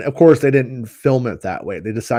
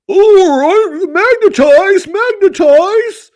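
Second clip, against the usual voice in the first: very high, loud squeaky alien voice; silly alien voice; 7 dB louder, much slower than usual. Retold or imitated. Mocking dubbed alien voices, a high loud squeak. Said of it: "That's every fucking voice".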